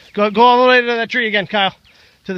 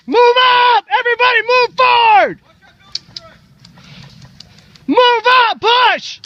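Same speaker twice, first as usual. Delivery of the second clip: shouted command; 8 semitones higher than usual. Real, not acted. Leader yelling team forward.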